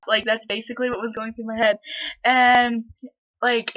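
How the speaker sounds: normal talking voice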